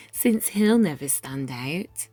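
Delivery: plain reading voice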